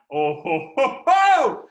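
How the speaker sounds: big high loud ho-ho laugh; hearty ho ho. Retold or imitated. Dad delighted at son's million-dollar goal, big loud laugh.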